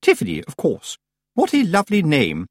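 high, grand lady's gracious voice; condescending politeness. A narrator's reading. Missus Earwig compliments the girl's name, chilly politeness. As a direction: praise her with formal, hollow graciousness. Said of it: "formal and chilly way".

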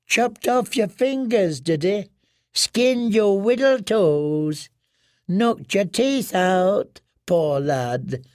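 high, falsely gentle woman's voice; mock-pitying washerwoman. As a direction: pretends sympathy before the kill. Rowan feigns pity.

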